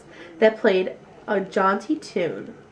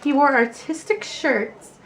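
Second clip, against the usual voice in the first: ordinary delivery, nothing marked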